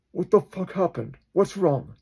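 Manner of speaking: high, urgent questioning; alarmed character. Acted. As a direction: demand answers in a high pitch. Acts alarmed characters at the door.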